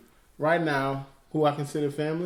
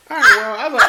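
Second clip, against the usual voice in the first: high and louder through laughter; laughing; 8 dB louder, faster than usual. Real, not acted. Brother retelling their argument, laughing hard and high. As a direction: talk through a real laugh.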